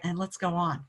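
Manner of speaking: usual speaking voice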